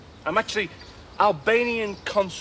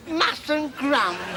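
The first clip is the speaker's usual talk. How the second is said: mustn't grumble; 7 semitones higher, much slower than usual. Retold or imitated. Repeated catchphrase, slow and high.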